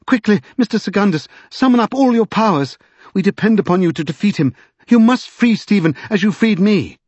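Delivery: high, impassioned urging; rally him to act at once; urgent cry. A narrator's reading. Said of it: "cried Lady Pole".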